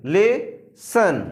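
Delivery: लेसन stretched very slowly, its end as "sən"; लेसन said "le-sən", with the o sounding like "a"; pronouncing lesson. Shown as a demonstration. Teacher stretches लेसन for the class. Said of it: "L E double S ओ N".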